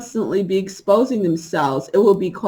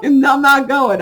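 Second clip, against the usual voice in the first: firm refusal; 6 semitones higher, 6 dB louder than usual. Retold or imitated. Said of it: "I'm like".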